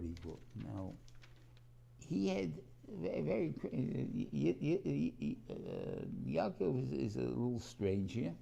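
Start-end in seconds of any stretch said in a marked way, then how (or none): none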